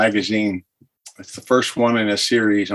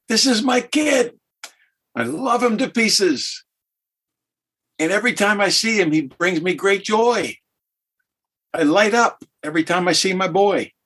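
high, beaming proud-dad voice; God the Father; 8 semitones higher than usual. Retold or imitated. The Father speaks of his son at baptism, high and beaming. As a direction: gush like a father glowing over his boy. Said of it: "he says, again, Steve International version".

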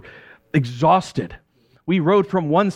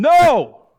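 a sharp, much higher, louder shout; yelled back; 10 semitones higher, 7 dB louder than usual. Acted. Tired fisherman yells back at stranger, loud frustrated shout. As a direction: fling a frustrated no back across the water. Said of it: "I yelled back at him".

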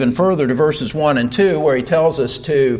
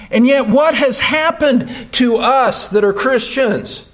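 impassioned preaching; 6 semitones higher than usual. Acted. Pastor pressing the congregation about their rescue, impassioned preaching. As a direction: build each clause toward a shout.

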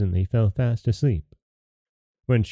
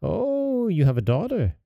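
high, eager dwarf exclaiming; 8 semitones higher than usual. A narrator's reading. Dwarf hearing of the daughter, high eager cry. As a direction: perk up at the news. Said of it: "cried the yellow dwarf".

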